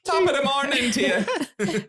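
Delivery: high-pitched, hammed-up Irish greeting; put-on Irish accent. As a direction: play up a stage-Irish accent. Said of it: "By an Irishman".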